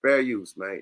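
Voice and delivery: usual speaking voice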